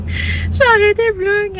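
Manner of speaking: high-pitched, just out of a laughing fit; cracking up. Real, not acted. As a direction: talk while still giggly from cracking up. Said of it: "je suis crampée".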